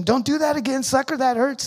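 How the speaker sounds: high pleading yell; scream. Retold or imitated. To doctors shocking him, a high pained yell. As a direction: cry out high in pain. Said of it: "I'm trying to scream".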